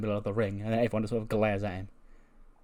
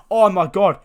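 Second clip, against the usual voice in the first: high, loud, gushing exclamation; overjoyed outburst; 8 semitones higher, 12 dB louder, slower than usual. Acted. Wrestler hearing he gets the title match, overjoyed. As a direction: burst out with hammed-up delight. Voicing the delighted chosen challenger.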